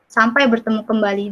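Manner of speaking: ordinary delivery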